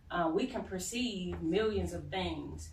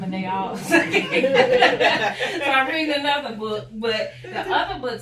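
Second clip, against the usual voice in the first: helpless real laugh through the words, louder and higher; 7 semitones higher, 13 dB louder than usual. Real, not acted. Reader mixing up multiple books at one time, laughing. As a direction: break up laughing mid-explanation. Cracks up over mixed-up books.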